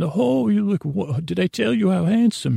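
high-pitched gushy compliment; gushing flattery. Acted. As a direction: fawn over the guest like an eager host. Acting out flattering restaurant staff.

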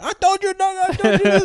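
high, loud scolding in a put-on voice; mock angry yell. The go-kart man scolding him after the crash, high and loud. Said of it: "And the dude's yelling".

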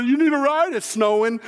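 hollered offer through the window; calling out. Retold or imitated. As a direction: offer the professor a ride over the snow. Calling out from truck.

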